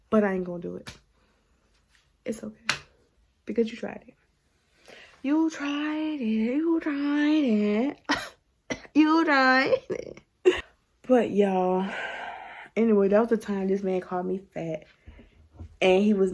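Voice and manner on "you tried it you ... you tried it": high, slow drawn-out taunt; taunting sing-song. Real, not acted. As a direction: stretch it out slowly, mocking him. Taunting him, sing-song repetition.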